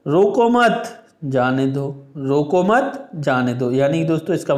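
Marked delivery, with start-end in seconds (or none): 0.1-2.0 रोको मत run together, then जाने दो; slowly bind रोको मत as one command, then break; pause after मत. Shown as a demonstration. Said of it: "बोलने का तरीका है वो इन कॉमाओं की वजह से दोस्तों बदल". 2.2-3.7 slow रोको मत as one phrase, then जाने दो; pause after मत. Shown as a demonstration. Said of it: "बोलने का तरीका है वो इन कॉमाओं की वजह से दोस्तों बदल".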